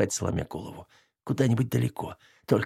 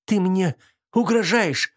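hissing; 9 semitones higher, slower than usual. Acted. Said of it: "зашипела девушка".

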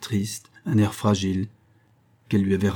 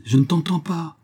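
high-pitched raised voice; shouted retort, pitched well up; crying out; 9 semitones higher, 3 dB louder than usual. Acted. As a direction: call out as if not hearing. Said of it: "criait-il".

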